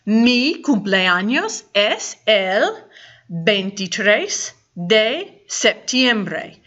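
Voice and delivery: slow, clear Spanish answer; careful teacher model. Shown as a demonstration. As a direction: say it slowly so learners copy. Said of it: "You will answer".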